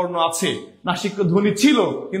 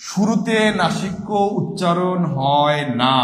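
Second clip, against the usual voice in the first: slow, deliberate, every word laid down; slow emphatic answer; slower than usual. Shown as a demonstration. Teacher drilling the correct answer into students, slow and deliberate. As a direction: spell the answer out slowly so it sticks. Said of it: "বারবার বলছি".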